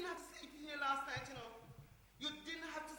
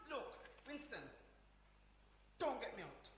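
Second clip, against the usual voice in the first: ordinary talk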